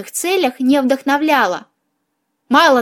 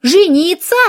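high, rising question of outrage; indignant; 5 semitones higher, 4 dB louder than usual. A narrator's reading. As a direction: squawk it in indignant disbelief. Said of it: "подчёркивая своё негодование".

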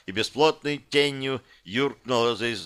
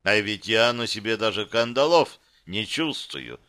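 ordinary delivery, nothing marked

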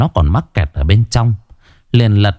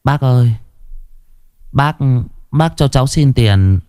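put-on shy, hesitant boy asking; halting, slightly higher plea with pauses; timid; 4 semitones higher than usual. A narrator's reading. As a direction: ask meekly, pausing between words. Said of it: "Nó rụt rè".

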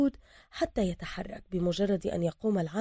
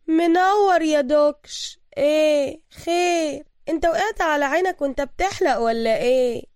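open sarcasm; 8 semitones higher, 6 dB louder than usual. Acted. Boss woman mocking the bruised thug, sarcastic. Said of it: "تقول بسخرية واضحة".

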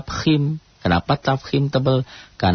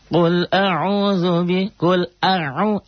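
high, slow chanted recitation; Quran recitation demo; 8 semitones higher, much slower than usual. Shown as a demonstration. Teacher chanting the verse for students, slow and high. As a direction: draw out the Arabic like a reciting teacher. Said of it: "alif dan ain".

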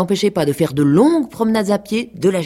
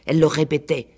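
usual speaking voice

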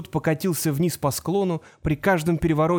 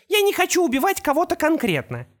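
high, cold villainess; Opal voice; 8 semitones higher than usual. A narrator's reading. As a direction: state it with calm arrogance.

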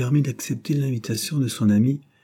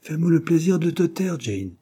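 piqued; 8 semitones higher than usual. A narrator's reading. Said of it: "d'un ton piqué".

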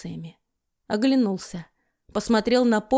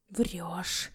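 hoarse, much softer rasp; wheezed; 4 semitones lower, 11 dB quieter than usual. A narrator's reading. Brother rasping his disbelief, hoarse and soft. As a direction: rasp it out almost without voice. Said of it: "прохрипел брат".

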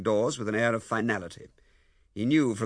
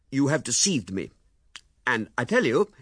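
loud, rising anger at his wife; furious accusation; 3 semitones higher, 6 dB louder than usual. A narrator's reading. Husband accusing his wife of deceit, loud anger. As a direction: build up to a rage.

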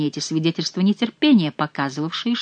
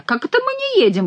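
high indignant cry; indignant question. A narrator's reading. Indignant monk asks Chicot, high-pitched. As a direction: squeal it out in outrage. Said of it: "возмутился монах".

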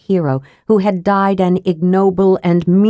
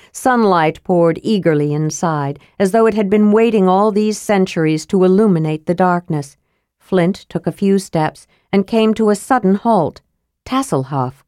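ordinary delivery, nothing marked

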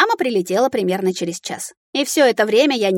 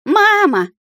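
put-on high, loud child's shout to mama; excited high call; exclaiming; 12 semitones higher, 6 dB louder than usual. A narrator's reading. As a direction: cry out like a little dragon. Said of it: "воскликнул дракоша".

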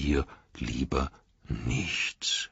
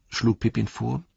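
ordinary delivery, nothing marked

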